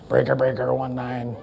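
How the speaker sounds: low CB trucker call; trucker cb radio. Retold or imitated. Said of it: "I remember C B radios".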